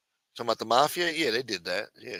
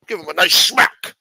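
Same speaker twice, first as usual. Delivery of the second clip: louder, higher Scottish Connery voice leaning on nice; Sean Connery accent; 6 semitones higher, 9 dB louder than usual. Acted. [name] urging a smack, loud Scottish Connery accent. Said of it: "If he didn't sound like Sean Connery".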